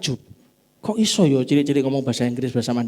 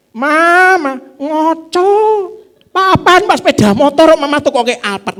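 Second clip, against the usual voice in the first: much higher and louder, a hammed-up whining wife; whiny wife; 14 semitones higher, 10 dB louder, slower than usual. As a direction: play the pouty wife begging for an Alphard. Wife voiced whining to husband.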